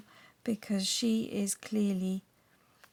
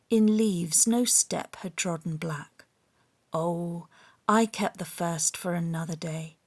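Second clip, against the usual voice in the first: reciting a favourite poem, hushed at the close; poem recitation; 4 dB louder than usual. Acted. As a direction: recite it like a treasured school poem. Recites Frost poem from memory.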